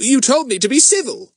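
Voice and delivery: aggrieved. A narrator's reading. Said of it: "said Fatty in an aggrieved voice".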